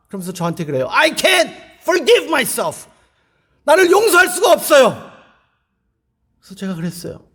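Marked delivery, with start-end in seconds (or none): 0.9-5.1 high, loud and drawn out in despair; anguished cry. Retold or imitated. A preacher voicing a sister who cannot forgive herself, anguished. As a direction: wail the guilt out slowly.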